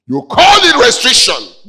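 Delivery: loud, high cry of accusation; full-voiced pulpit outburst; preacher's shout. Real, not acted. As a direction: shout it at the congregation.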